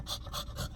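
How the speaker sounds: quick, soft intake of breath; mock smitten gasp. Imitating her old self spotting a fine man, smitten gasp. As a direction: gasp as if instantly taken with someone. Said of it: "the old [name] would have went".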